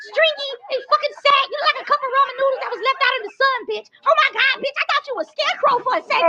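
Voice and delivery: high voice. Acted. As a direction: sneer it up high and quick. Catwoman roast in a shifted voice.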